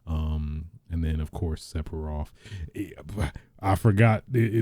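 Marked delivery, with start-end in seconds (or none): none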